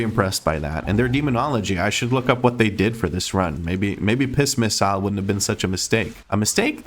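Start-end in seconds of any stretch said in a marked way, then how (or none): 6.3-6.8 much higher, rising disbelief at his own word; incredulous question. Real, not acted. Player doubting his own word mid-commentary, incredulous rise. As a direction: catch yourself and question the word.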